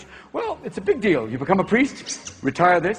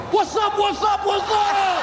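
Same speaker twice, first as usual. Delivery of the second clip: hammed-up street talk; 12 semitones higher, much slower than usual. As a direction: shout it like a hammed-up hip homeboy. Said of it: "immediately go".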